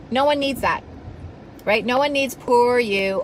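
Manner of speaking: ordinary talk, nothing marked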